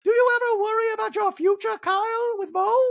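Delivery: very high put-on questioning; stagey, piercing impression of concern; Dorit. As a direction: probe in an exaggerated high-pitched voice. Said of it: "Then we see Dorit going".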